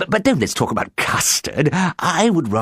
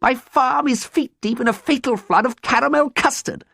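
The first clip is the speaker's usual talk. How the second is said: very high, hammed-up wailing; broken-hearted lament; 4 dB louder than usual. A narrator's reading. Ruined farmer bemoans his custard-flooded farm, high sobbing wail. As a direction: pile up the woes in a high wail. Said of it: "he sobbed broken-heartedly".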